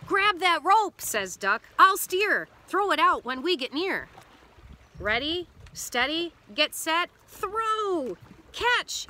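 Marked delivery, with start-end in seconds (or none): 0.1-1.0 high, loud rescue order; urgent command. A narrator's reading. Duck orders during a rescue. 1.8-4.1 raised, louder instructions; urgent command. A narrator's reading. Duck orders during a rescue. 5.0-9.1 rising count to a high call; building yell. A narrator's reading. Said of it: "yells Duck".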